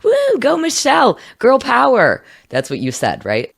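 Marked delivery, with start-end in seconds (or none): 0.0-2.2 squealed high cheer, far higher; hammed-up high-pitched girl-power cheer; mock cheering. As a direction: cheer her on like a fan. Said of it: "And you're like".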